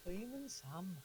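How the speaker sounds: high, soft Welsh echo; Welsh accent. Pretend. Co-host echoing the Fireman Sam line, mock Welsh accent. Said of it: "I struggle doing the Welsh accent".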